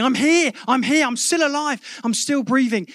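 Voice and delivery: high, urgent mock shout; acted shouting. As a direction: call out from under the rubble, pitched well up. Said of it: "You start shouting".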